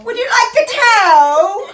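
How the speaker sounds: high and loud, bright encouragement; animated coaxing. Acted. Therapist coaxing a shy child to say the word. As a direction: lift the child's energy with a high bouncy question.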